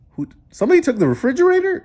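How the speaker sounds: high, fast astonished question; amazed. Acted. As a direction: blurt it out in disbelief. Reader amazed at the fridge.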